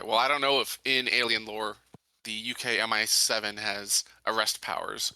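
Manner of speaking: normal talking voice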